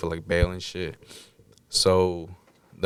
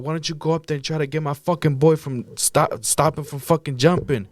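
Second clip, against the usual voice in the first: high, heated challenge; retold angry yell; 7 semitones higher than usual. Demanding cops save his friend, retold angry yell. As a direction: re-enact berating them, pitch raised. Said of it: "I'm yelling at the cops".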